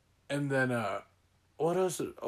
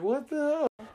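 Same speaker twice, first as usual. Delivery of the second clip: much higher disbelieving question; high baffled what the hell; 4 dB quieter than usual. Retold or imitated. Coworkers baffled by the driver, high and incredulous. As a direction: squeak it in disbelief. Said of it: "we're just like".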